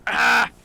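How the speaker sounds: short, high, loud "ah"; sudden cry. Real, not acted. Browsing Pinterest during the podcast, a sudden loud cry. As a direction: burst out with one loud high yelp.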